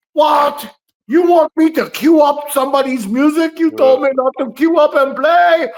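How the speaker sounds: high, loud, put-on shout of a flustered man; frantic shouted impression. Doc Haywood baffled over cueing up music, in a high, hammed-up shout. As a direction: yell it like a panicked music operator. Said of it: "and he's like".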